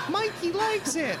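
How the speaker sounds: slow, high sing-song ad line; ad quote voice. Retold or imitated. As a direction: coo it like an old TV ad. Quoting old cereal ad.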